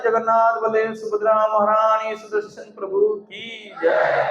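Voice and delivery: shouted, drawn-out jaikara; devotional acclamation. Real, not acted. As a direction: call out to lead the congregation's cheer. Leads devotional victory cries.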